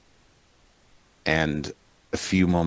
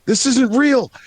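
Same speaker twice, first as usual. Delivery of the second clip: high-pitched panic, climbing and getting louder; freaking out; 14 semitones higher, 3 dB louder than usual. Acted. First gnome seeing someone thought dead, freaking out. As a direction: cry out in horrified disbelief, building to a yell. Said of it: "the first guy's freaking out".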